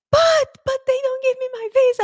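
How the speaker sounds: put-on high whine of complaint; mock whiny protest. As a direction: imitate a student wailing in protest. Student's protest voiced in story.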